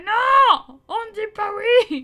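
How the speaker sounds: loud, high scolding father; mock furious dad shout. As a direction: yell the lesson at the kid. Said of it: "Il fait".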